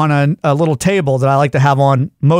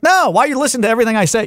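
quick, higher-pitched objection; indignant protest; 7 semitones higher, faster than usual. Retold or imitated. Man objecting to his eavesdropping Alexa, indignant protest. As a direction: protest fast and exasperated. Retold protest to nosy smart speaker.

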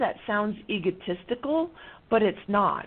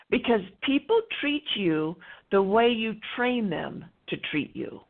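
ordinary talk, nothing marked

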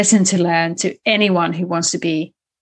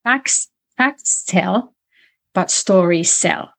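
slogan, slowly; slower than usual. Shown as a demonstration. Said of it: "And I always say this".

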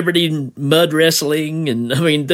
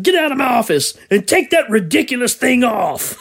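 high, laughing boss order; barking tyrant boss; 7 semitones higher than usual. Retold or imitated. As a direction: bark the order while laughing.

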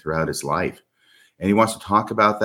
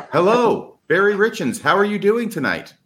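much higher, bright host welcome; enthusiastic greeting; 12 semitones higher than usual. Real, not acted. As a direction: greet the guest like a game-show host. Host welcomes guest on air.

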